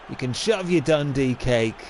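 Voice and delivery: normal talking voice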